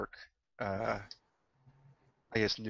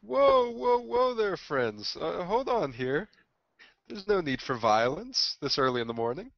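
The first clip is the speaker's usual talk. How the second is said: peacemaking holy man; 7 semitones higher than usual. Acted. Holy man steps into fight.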